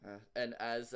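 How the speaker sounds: normal talking voice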